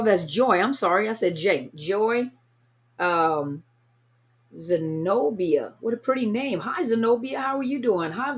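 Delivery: ordinary delivery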